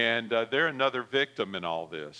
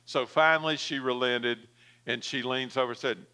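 ordinary talk, nothing marked

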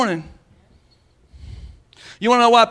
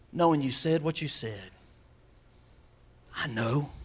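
devil's whisper; 7 semitones lower, 10 dB quieter than usual. Retold or imitated. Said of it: "the devil's sitting right there on their shoulder saying".

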